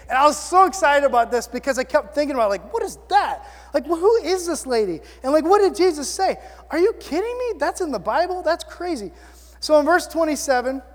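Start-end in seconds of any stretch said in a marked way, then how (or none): 2.7-9.1 high-pitched, animated disbelief; incredulous reaction. Retold or imitated. Preacher replaying his first read of the verse, incredulous. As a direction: replay your amazement, voice jumping up.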